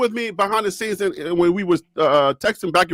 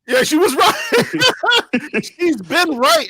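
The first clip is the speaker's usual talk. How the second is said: laughing; 7 semitones higher, much slower than usual. Real, not acted. Laughing at being proved wrong.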